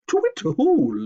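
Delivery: high, loud hooting huff; owl hoot. Acted. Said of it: "Liam huffed".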